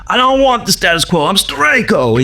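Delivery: high, defiant proclamation; Steranko impression. Comic artist refusing the publishers' rules, defiant high voice. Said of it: "I'm kinda putting a few words in his mouth".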